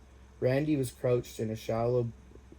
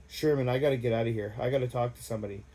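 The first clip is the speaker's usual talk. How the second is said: hurried panic; faster than usual. Acted. Panicking to escape.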